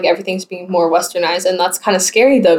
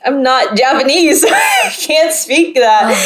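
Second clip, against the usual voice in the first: high, drawn-out retold protest breaking into laughter; exasperated protest; 5 semitones higher, slower than usual. Laotian student to ignorant classmates, exasperated and laughing. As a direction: stretch it out high, laughing in disbelief.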